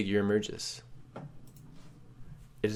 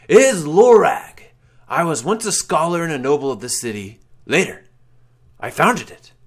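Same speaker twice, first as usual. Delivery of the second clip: solemn intoning; 6 semitones higher, 10 dB louder than usual. Acted. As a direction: declare his legend as an ancient wraith. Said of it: "the figure intones".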